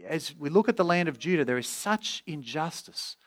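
Habakkuk's plea. Acted. Preacher voices the prophet asking God to act, pleading. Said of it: "Habakkuk is saying".

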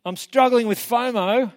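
a hammed-up nephew pleading with his uncle; whiny nephew. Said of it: "his nephew comes to him and says".

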